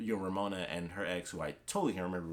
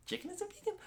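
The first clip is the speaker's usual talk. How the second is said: much higher, softer, incredulous rising question; shocked disbelief; 6 dB quieter than usual. Retold or imitated. Envy realizing the chicken wasn't vegan, shocked squeak. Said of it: "gasps and looks at him".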